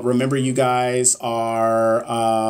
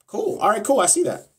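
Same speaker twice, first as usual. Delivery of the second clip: a sudden, much higher, light "cool"; mock surprised cool; 9 semitones higher than usual. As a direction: toss off "cool" way up high as a playful mock reaction. Mock reaction to viewer's plan.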